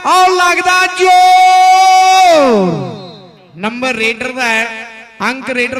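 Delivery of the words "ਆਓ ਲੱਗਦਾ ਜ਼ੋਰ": long, loud, high stretched call; drawn-out commentator shout. Acted. Climax of contest call.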